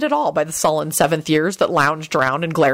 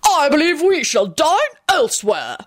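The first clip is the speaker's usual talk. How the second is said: haughty lady; 10 semitones higher than usual. A narrator's reading. Sylvia leaves haughtily.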